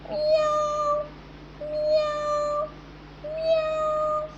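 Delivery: high mewing cat imitation; playful high cat meows for the class. Shown as a demonstration. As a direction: meow like a kitten, high and drawn out. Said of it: "this is a cat sound".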